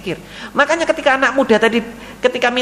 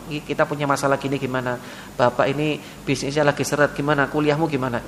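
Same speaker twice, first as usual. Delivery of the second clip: soft confiding; 8 semitones lower, 7 dB quieter than usual. Acted. Parent confiding in child.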